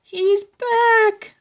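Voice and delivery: high, loud panicked gasp; frightened cry. A narrator's reading. Webster seeing the doll is back, high and loud with fear. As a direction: blurt it out in alarm. Boys terrified by doll's return.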